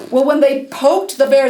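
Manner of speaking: usual speaking voice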